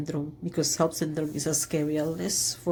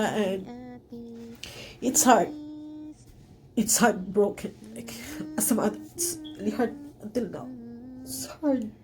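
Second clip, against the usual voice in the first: crying; 7 semitones higher than usual. Real, not acted. Mother breaks down over NICU.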